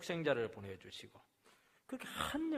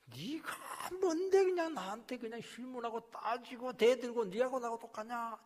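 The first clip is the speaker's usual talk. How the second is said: slow, higher stern rebuke from above; God rebuking; 9 semitones higher, 3 dB louder than usual. Retold or imitated. God rebuking an insolent believer, stern voice. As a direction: scold the arrogant questioner slowly. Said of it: "불경하게 나오면 막 책망".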